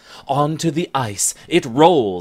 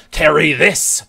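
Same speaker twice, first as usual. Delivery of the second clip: louder, glaring retort; angry retort; 4 semitones higher, 7 dB louder than usual. Acted. Scot glaring at Bennet: loud angry retort. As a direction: fling it back at her angrily. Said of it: "the Scottish giant glares".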